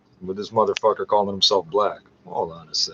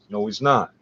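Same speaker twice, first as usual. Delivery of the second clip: ordinary talk, nothing marked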